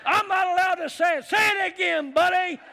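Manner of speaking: high, loud, hammed-up yelling; mock angry passenger. Pastor acting out the cussing passenger yelling at the crowd, hammed-up. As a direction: play the furious stranger shouting.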